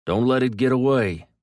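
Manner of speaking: loud urgent call; urgent shout. A narrator's reading. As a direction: shout it after the truck. Urgent chase.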